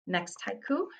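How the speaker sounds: ordinary talk, nothing marked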